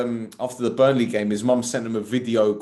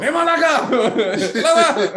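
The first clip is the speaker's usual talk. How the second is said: loud, high mock yell with laughter; imitating someone's mum; 11 semitones higher, 6 dB louder, slower than usual. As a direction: holler like an angry mum in jest. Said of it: "imagine his mum talking like that".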